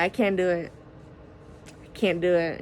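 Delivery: normal talking voice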